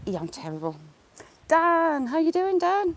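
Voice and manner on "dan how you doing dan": high, loud sing-song hello to a bird; cooing greeting. Real, not acted. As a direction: chirp the name up high like to a pet.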